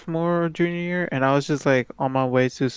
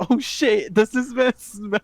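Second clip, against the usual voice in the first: mock surprise; 7 semitones higher, slower than usual. Retold shocked inner thought.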